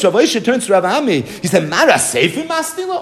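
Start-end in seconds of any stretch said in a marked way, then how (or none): 1.7-3.0 a laughing, much higher disbelieving question; incredulous challenge. Acted. Rav Oshia confronting Rav Ami, laughing disbelief. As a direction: ask it like you caught him out.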